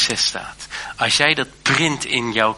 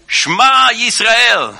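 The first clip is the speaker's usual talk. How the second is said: a high, loud, slow cry across the yard; called out loud; 11 semitones higher, 9 dB louder, slower than usual. Retold or imitated. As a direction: call it out like a summons. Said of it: "Hij riep dat uit".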